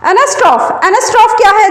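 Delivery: usual speaking voice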